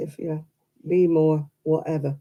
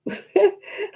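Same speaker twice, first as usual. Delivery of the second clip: high laugh. Real, not acted. Laughs at Plymouth Fury.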